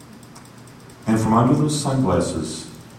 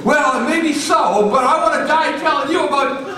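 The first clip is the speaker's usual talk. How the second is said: sing-song brave preacher; 4 dB louder than usual. Retold or imitated. Miguel answering the threat, defiant and sing-song. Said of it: "Miguel's going".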